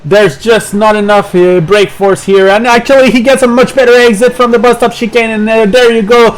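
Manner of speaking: high, loud race call; excited motorsport commentator. Real, not acted. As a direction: shout the pass as it happens. Live race play-by-play.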